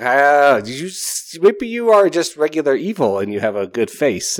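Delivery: hammed-up suspicion of the prince; disgusted realization. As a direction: recoil at the creep. Reacting to prince's boast.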